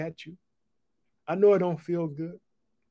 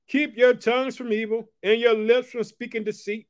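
raised, ringing scripture reading; scripture proclamation; 5 semitones higher than usual. Acted. Preacher reading the psalm to his viewers, ringing and raised. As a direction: proclaim each phrase to the congregation.

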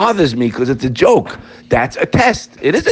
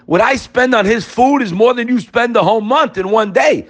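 high-pitched indignant protest; protest, offended, way up high; indignant master; 10 semitones higher than usual. Acted. Master defending himself on the phone, indignant.

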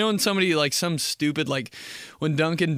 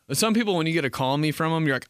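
ordinary delivery

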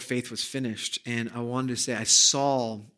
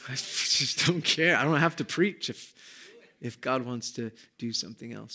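ordinary talk

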